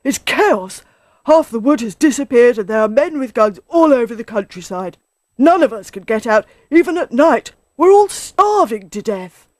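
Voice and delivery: loud, high, agitated alarm; worked-up badger, voice climbing; excited. A narrator's reading. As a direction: report a disaster in a panic. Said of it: "Badger said excitedly".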